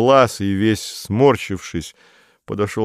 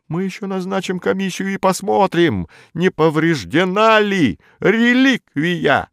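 pompous bureaucrat; 12 semitones higher than usual. A narrator's reading. Modest, pompous administrator.

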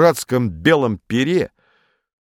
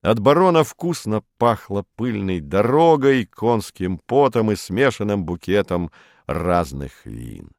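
plain reading voice

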